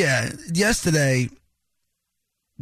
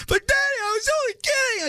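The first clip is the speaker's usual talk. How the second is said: put-on much higher, childlike pleading; whiny kid voice. Father imitating his son begging out of the bet, high whiny kid voice. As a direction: squeak it out like a little boy caught out.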